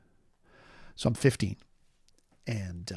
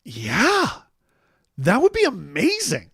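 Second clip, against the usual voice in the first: high, thrilled acceptance; excited yes; 7 semitones higher, 4 dB louder, slower than usual. Retold or imitated. Teen boy accepting the toys, high and thrilled. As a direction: jump at the offer, delighted. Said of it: "I'm like".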